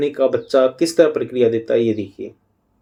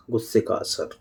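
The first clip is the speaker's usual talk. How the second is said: ordinary talk, nothing marked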